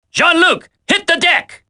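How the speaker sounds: very high, sudden warning cry; warning shout. Acted. Ally warns Jean-Luc of gunfire, high shout. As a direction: yell at him to drop.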